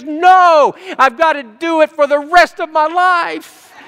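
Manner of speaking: put-on high, loud, slowed child's wail; wailing little girl. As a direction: play a little girl sobbing over her shoes in high pitch. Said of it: "she's in there just crying and sobbing".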